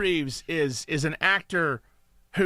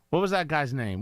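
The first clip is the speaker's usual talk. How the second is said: ordinary talk, nothing marked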